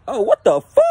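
loud, high-pitched jolt of alarm; real startle. Real, not acted. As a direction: cry out, caught off guard. Startled by something sneaking up.

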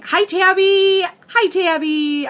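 high, drawn-out squeal of hello; sing-out high greeting to a viewer; excited greeting. Real, not acted. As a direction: call out a delighted, stretched-out hi. Greeting a viewer joining live.

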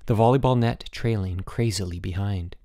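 ordinary delivery

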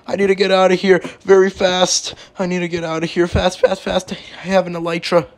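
high, hurried pleading; urgent panic. Acted. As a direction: play up the rush to escape. Must escape the falling plane.

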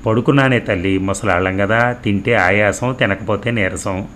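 plain reading voice

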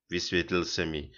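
ordinary delivery, nothing marked

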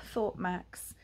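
ordinary delivery, nothing marked

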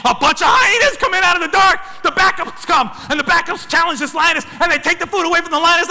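high, loud play of rising chaos; worked-up storyteller near shouting; excited retelling. Retold or imitated. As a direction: announce each twist at full pitch. Climax: hyena backups arrive.

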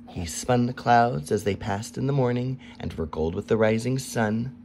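ordinary delivery

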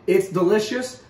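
ordinary talk, nothing marked

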